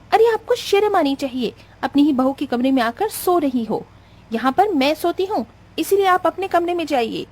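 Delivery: high, haughty telling-off; indignant scolding. Acted. Acted quarrel, bride scolds mother-in-law. (गुस्सैल बहू की ऊँची फटकार)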